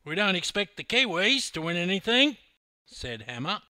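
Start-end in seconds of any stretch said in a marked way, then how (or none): 0.0-2.4 loud, high, cocky bragging voice; loud cocky larrikin bragging; brash Aussie boast. A narrator's reading. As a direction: swagger up to the officer and announce it. Hammer taunting officer.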